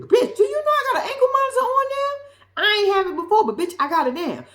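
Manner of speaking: hammed-up ex-con scolding his girl; mock angry boyfriend. Derek in the car yelling at his girlfriend, in a mock exasperated rant.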